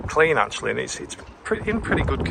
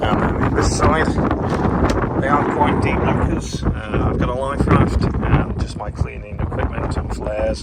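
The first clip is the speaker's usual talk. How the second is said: talking outdoors in strong wind; wind noise; 3 semitones higher, 5 dB louder than usual. Real, not acted. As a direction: wind howling across the mic. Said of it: "Excuse the wind noise".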